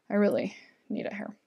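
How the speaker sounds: a real whisper under her breath; whispered aside. Real, not acted. Host whispering a quick aside between reading comments. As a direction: whisper it to yourself, off to the side.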